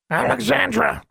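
high squawking bird voice; raven squawk. A narrator's reading. Agitated raven calling to its owner, squawking. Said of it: "squawked the raven".

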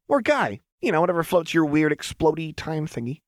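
high, sing-song teasing; teasing Jinx. Acted. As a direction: let him down with mock sweetness.

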